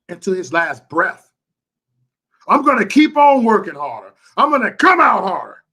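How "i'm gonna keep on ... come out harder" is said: loud, high, forceful promise; fierce vow. Real, not acted. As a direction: belt it out with determination. Vowing to work harder.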